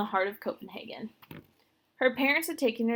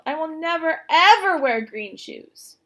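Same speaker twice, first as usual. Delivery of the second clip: high, loud, slow protest, leaning on ever; stubborn refusal; 6 semitones higher, 11 dB louder than usual. A narrator's reading. Little sister refusing shoes, loud and slow. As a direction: dig in your heels, drawn out.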